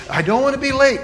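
lightly voiced fretting boy, high pitch; a touch of a worried kid; anxious child. Impatient boy frets to his mother.

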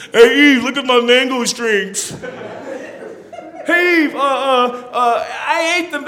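a mild mock proud Adam to Eve; goofy boasting Adam. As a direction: show off like a proud kid.

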